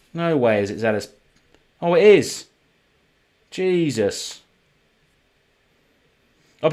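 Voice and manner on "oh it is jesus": higher and louder, a shocked oh; surprised realisation. Real, not acted. Card opener discovering he misread the card all day, shocked. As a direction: caught out by his own mistake.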